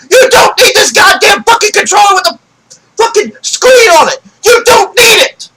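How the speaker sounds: high, very loud furious rant; real angry shouting. Real, not acted. Fan berating Nintendo over gimmick controllers, angry shouting. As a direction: yell it at full force, fed up. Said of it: "Yes, I'm getting pissed".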